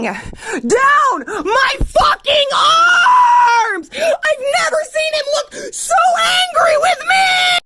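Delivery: high, drawn-out tearful shriek; distraught wail. Real, not acted. Telling how the mess enraged Charles, distraught wail. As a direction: wail every word out long and high. Distraught climax of bathroom mishap.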